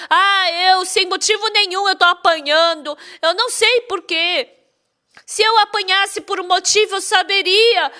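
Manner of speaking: high-pitched wailing lament, without real tears; imitated tearful complaint. Woman complaining to pastor of unexplained beatings, mock tearful lament. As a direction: act out a wife's plaintive grievance. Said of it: "E aí chorando veio".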